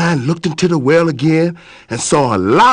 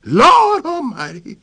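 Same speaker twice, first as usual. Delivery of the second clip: startled yell; 10 semitones higher, slower than usual. Acted. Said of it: "he yelled to Boss Lion".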